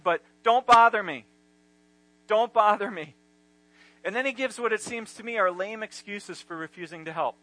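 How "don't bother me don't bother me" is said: irritated. Retold or imitated. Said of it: "look how the man in bed responds".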